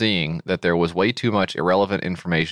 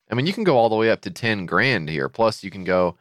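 usual speaking voice

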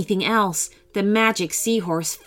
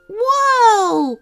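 high startled whoa; surprised cry; 13 semitones higher, 5 dB louder than usual. Acted. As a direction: shout it as you duck. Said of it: "cried the girls".

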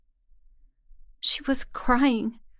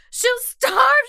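starve cried high and loud in panic; high frantic plea; 13 semitones higher, 6 dB louder than usual. Acted. The captive begs about her mother, high frantic plea. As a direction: plead it, voice climbing. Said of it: "I kept droning on the same thing".

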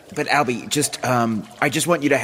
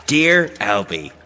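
slow ominous read; 4 dB louder, slower than usual. Acted. Reading the note aloud.

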